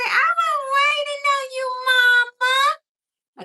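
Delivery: very high, loud squeaky pet voice; voicing the dog. Owner voicing her dog's reply, high squeaky and loud. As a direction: speak for the dog in a tiny squeal.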